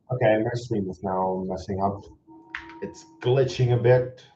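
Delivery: usual speaking voice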